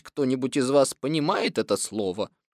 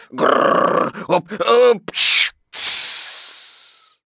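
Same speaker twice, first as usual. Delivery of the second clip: growling splutter ending in long hiss; rolled growl, gulps, then explosive sneeze; exaggerated tiger coughing fit; loud mock cough-sneeze; 5 semitones higher, 6 dB louder, much slower than usual. A narrator's reading. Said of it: "У меня просто был кашель".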